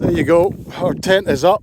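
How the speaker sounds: loud over howling wind; shouting over wind. Real, not acted. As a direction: push your voice over the gale. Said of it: "hear me over this wind".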